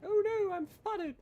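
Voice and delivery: high, squeaky cry of alarm; hammed-up panic at being spotted; mock alarm. Real, not acted. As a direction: yelp it like you've been caught. Ship spotted in battle.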